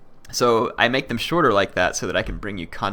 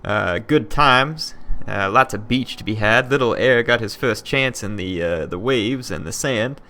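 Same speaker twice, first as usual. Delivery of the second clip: higher, hammed-up Southern drawl, a bit slow at times; put-on Southern accent; 3 semitones higher, 5 dB louder, slower than usual. Greeting YouTube viewers back from vacation in a mock Southern accent. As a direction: play up a Kentucky-fried Southern accent for the viewers. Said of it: "I can't do that for the whole episode".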